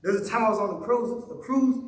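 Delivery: normal talking voice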